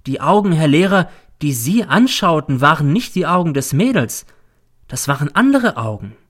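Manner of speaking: lightly voiced pupil, suddenly ceremonious; oddly solemn. A narrator's reading. Pupil reveals a secret to his teacher, solemn. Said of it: "plötzlich wird er sonderbar feierlich".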